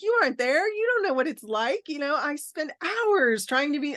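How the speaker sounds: replaying a hurt, defensive reply; indignant retort. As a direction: snap back in defensive frustration. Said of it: "my instant reaction was like".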